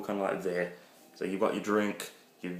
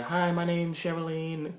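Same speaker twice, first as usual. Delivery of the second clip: put-on girly voice; 10 semitones higher, slower than usual. Girl introducing herself.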